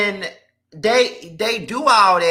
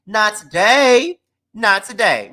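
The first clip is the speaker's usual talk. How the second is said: slow emphatic; 4 dB louder, slower than usual. Real, not acted. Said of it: "emphatic refusal".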